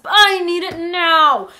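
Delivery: put-on slow, loud, drawn-out kid whine; demanding little brother. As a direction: imitate a child's tantrum demand.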